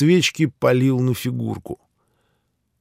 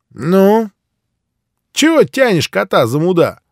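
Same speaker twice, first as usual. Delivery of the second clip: loud impatient snap at him; barking old man; 9 semitones higher, 7 dB louder than usual. Acted. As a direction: bark it out, annoyed. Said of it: "рявкнул старик".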